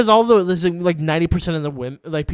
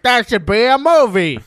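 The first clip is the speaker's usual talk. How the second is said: mock Franco enthusiasm; 8 semitones higher, 9 dB louder than usual. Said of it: "James Franco's like".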